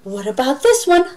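mock-curious teacher; playful questioning. Shown as a demonstration. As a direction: tease the kids along.

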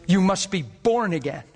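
usual speaking voice

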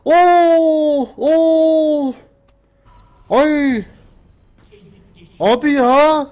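high, loud panicked cries; shrieking in real panic as the enemy chases; yelping panic while fleeing. Real, not acted.

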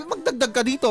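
ordinary talk

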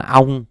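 "ông" as the Vietnamese word, lips closing at the end; wrong pronunciation. Shown as a demonstration. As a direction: say "ông" with a Vietnamese rounded ending. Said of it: "chúng ta lưu ý đừng đọc là".

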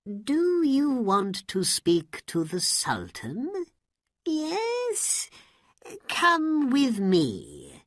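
high, slow, haughty court official; pompous vizier. Acted. Vizier receiving a poor woman at court, haughty and slow. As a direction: look down your nose at her, slowly. Vizier greets Aladdin's mother.

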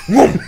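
high, loud whoosh as he throws it all; throwing sound. Retold or imitated. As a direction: fling everything out with a loud high whoom. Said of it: "just went".

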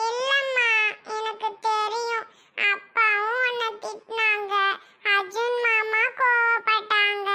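put-on upset little child. Child blaming self for mother's scolding, sad. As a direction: blame yourself like a hurt child, tearfully.

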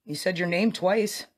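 high, loud call into the dark; calling out to a ghost. Real, not acted. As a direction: call out to the spirit, slowly at the end.

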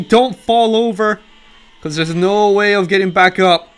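normal talking voice

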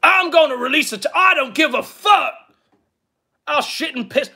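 high, hurried mock rant; Ethan Ralph. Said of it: "a really great Ralph impression".

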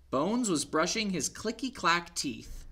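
lively picture-book read-aloud; story reading to children. A narrator's reading. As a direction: read it to little ones.

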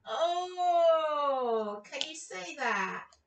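ordinary delivery

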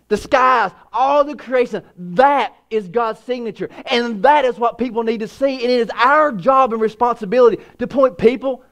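rising fervent preacher's cry; full-force preaching. Acted. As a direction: preach it hard to the congregation.

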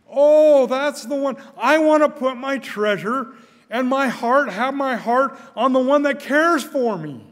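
fervent pulpit preaching, building in power; full-force preaching. Acted. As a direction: preach it out to the congregation with fervor.

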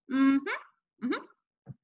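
high hummed reply, muffled; closed-mouth mumble. A narrator's reading. Boy answering mother with stuffed mouth, mumbling. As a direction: hum twice through sealed lips. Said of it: "trying not to open my mouth".

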